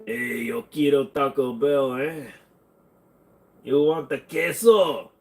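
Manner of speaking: slow, hammed-up Mexican accent; exaggerated Mexican accent with stretched vowels. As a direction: draw the words out in a mock Mexican accent. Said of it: "do a good Mexican accent".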